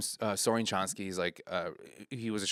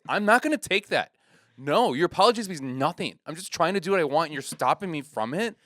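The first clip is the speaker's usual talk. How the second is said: higher, louder imitated indignation; upset comedian; 7 semitones higher, 5 dB louder than usual. Comedian rejecting a heckler's apology, imitated upset. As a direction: refuse the apology, worked up and hurt. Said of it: "my friend gets upset".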